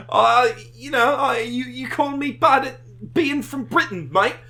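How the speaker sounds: put-on high, loud London gangster accent; English accent. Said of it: "bad English accents".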